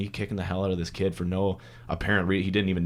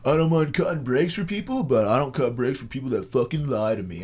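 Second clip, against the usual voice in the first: lightly imitated cop, raised at first; cop role; 4 semitones higher than usual. Cop accusing the driver of lying, mock cop voice. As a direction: lay down terms like an offended cop. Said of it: "he's like".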